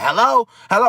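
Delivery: loud high repeated hello, shouting down the line; yelling on the phone. Retold or imitated. Friend yelling hello at the storyteller over the phone. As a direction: bark hello into the phone, fired up. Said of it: "he gets to yelling".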